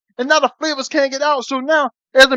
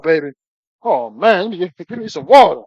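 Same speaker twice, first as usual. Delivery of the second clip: ordinary delivery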